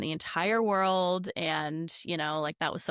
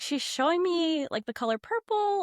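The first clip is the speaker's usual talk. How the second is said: puzzled questioning; 9 semitones higher than usual. Retold or imitated. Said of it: "I was like".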